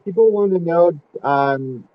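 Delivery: muffled voice through a wall. Real, not acted. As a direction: talk through an unplugged headset, muffled. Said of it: "[name], you sound muffled".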